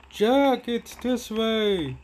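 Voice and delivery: high, drawn-out imitation of yelling viewers; mock viewer yell. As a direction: call it out high, like a pretend heckler. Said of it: "You guys are probably gonna yell".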